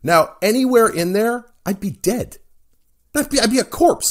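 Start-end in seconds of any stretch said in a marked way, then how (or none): 3.1-4.1 outraged. Real, not acted. Exaggerated outrage at BMI.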